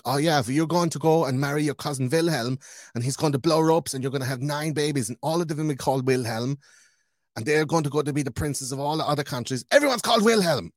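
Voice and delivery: mocking posh relative, loud on the punchline; mock royal gossip. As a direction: play a giddy royal plotting marriages. Mocking royals planning cousin marriages.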